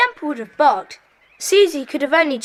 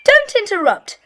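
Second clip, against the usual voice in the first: higher and louder, cutting her off; sharp rebuke; 7 semitones higher, 5 dB louder than usual. Acted. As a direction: snap at her to stop interrupting. Leader cuts in on Pam.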